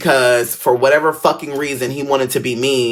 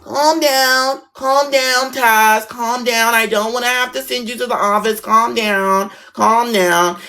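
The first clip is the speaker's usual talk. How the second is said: put-on high, wavering old lady teacher voice, much higher; 6 semitones higher, slower than usual. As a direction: play the flustered old teacher trying to settle the class. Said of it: "She was old as hell".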